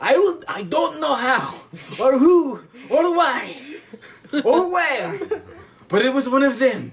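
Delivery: high, loud mock French accent; hammed-up French detective declaration; each phrase swooping, badly faked French; Poirot. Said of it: "And he's like".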